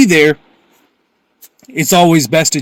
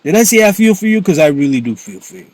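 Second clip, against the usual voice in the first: normal talking voice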